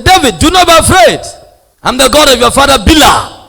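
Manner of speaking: booming high voice of God calling David. Retold or imitated. Preacher voicing God calling David, booming. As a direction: proclaim it like God speaking from above. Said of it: "and the voice said".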